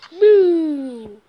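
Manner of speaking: shouted sound effect boom; loud boom. Acted. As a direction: yell a big explosion noise. Jump effect in game.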